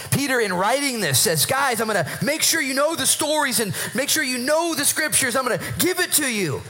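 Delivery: high-pitched, fervent preaching; real excitement, driving the point home; excited. Real, not acted. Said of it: "I'm excited".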